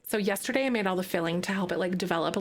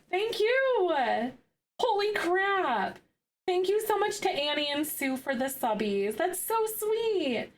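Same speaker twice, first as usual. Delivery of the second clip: overwhelmed thanks; 9 semitones higher than usual. Real, not acted. Streamer thanks Annie and Sue for subs, high and touched. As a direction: let the gratitude spill out high.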